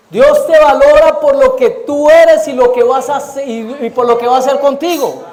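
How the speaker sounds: loud, fervent sermon voice, driving each phrase home; full-force preaching. Acted. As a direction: proclaim it to the whole church. (predicación fervorosa y en voz alta)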